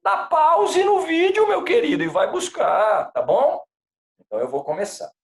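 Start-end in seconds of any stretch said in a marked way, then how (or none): 0.0-3.7 high, lively coaxing; excited urging. Real, not acted. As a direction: cheerfully urge them to go get it. Urging students to fetch handout.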